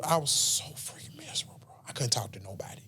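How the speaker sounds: near-whisper, voice almost gone; hushed confession. Real, not acted. Young man confessing to his friends, whispering. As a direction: drop to a breathy hush as if ashamed to say it.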